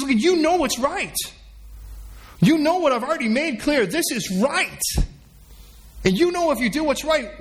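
high-pitched, pressing reproof; God's insistent rebuke. Acted. God confronts Cain over his unacceptable sacrifice, insistent.

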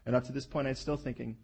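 ordinary talk

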